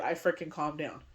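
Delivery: normal talking voice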